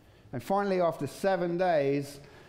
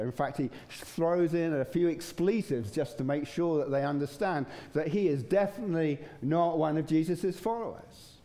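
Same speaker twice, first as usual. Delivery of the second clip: ordinary talk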